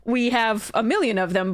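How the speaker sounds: normal talking voice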